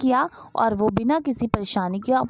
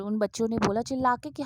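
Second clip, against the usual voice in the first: usual speaking voice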